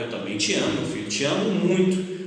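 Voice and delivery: a father's soft, loving answer; tender reply. Retold or imitated. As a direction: murmur it lovingly to a little son.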